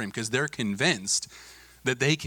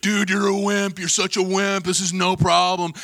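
higher, louder mock jeer from a cocky kid; imitated taunting; 6 semitones higher, 4 dB louder than usual. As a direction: heckle your buddy for quitting. Bigger kid taunts the quitter.

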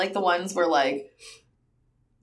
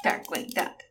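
whispered; 5 semitones lower, 7 dB quieter than usual. Pretend. Teasing co-host on purpose.

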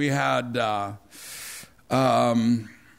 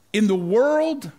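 slow, raised and louder on world; preaching emphasis; 5 semitones higher, 4 dB louder, slower than usual. Real, not acted. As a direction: lean into "world" with weight. Preacher slows to quote scripture.

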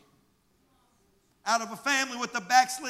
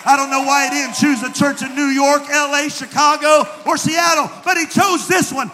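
louder, shouted declaration of God's choice; full-force preaching; 4 semitones higher, 13 dB louder than usual. Acted. Pastor proclaims God's favor on the church, shouted preaching. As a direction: proclaim it like a promise.

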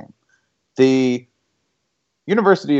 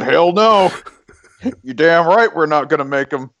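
higher-pitched defiant retort; hammed-up cocky refusal; mock defiance; 6 semitones higher than usual. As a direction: play an engineer indignantly refusing. Acting out the engineers' defiant reply.

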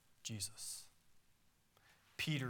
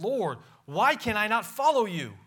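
urgent protest; 7 semitones higher, 8 dB louder than usual. Acted. Peter's protest acted out.

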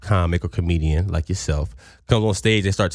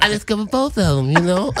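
high smooth-talker voice; playful lisping impression; Bob Sumner impression; 12 semitones higher than usual. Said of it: "We're doing a Bob Sumner off".

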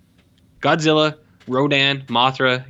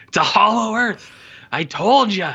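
higher, hammed-up film dialogue; mock exclamation; 7 semitones higher than usual. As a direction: announce it like an overexcited theorist. Mocking overdone film dialogue.

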